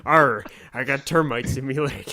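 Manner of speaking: silly pirate growl; joking Caribbean-movie pirate accent. Pretend. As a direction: do a hammy pirate. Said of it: "That's fucking Pirates of the Caribbean".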